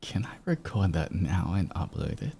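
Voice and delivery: hushed aside under the breath; whispering. Real, not acted. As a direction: whisper it so the mic won't catch it. Aside while setting up recording.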